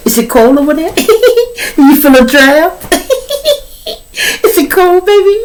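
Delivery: very high, sing-song mock sweetness, laughing between lines; taunting wife. Wife on the phone mocking him, laughing and high-pitched. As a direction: tease him like he's the fool.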